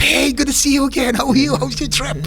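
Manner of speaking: over-eager high-pitched imitation of a greeting; high, hammed-up welcome; dial turned up. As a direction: gush at a supposed celebrity, voice way up. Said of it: "the dial is turned up a little bit".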